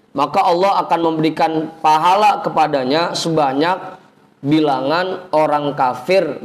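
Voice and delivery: ordinary talk, nothing marked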